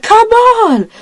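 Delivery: high, loud exasperated yell; frustrated shout. Retold or imitated. Child acting the crewman shouting at the slow answers. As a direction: snap it out, fed up. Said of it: "He's like".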